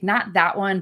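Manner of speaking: normal talking voice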